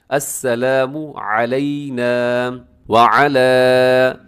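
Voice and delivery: very slow chant keeping व and अला apart; tajweed recitation; separate wa ala. Shown as a demonstration. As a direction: stretch व अला into two words. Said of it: "वला नहीं पढ़ने से".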